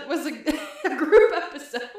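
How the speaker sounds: laughing through the words. Retold or imitated. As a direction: giggle while saying it. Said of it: "I was like".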